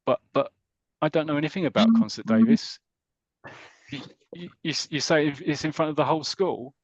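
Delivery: a frightened boy protesting to the prefect, mock-scared. Mike pleads with prefect Davis about the concert, mock-scared and stammering. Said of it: "really scared about this".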